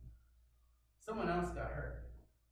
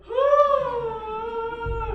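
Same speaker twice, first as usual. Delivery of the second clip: much higher, much louder shriek of shock; horrified scream; 13 dB louder than usual. Retold or imitated. Man seeing his own bone and tendon, screaming in horror. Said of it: "And I go".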